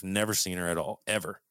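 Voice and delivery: usual speaking voice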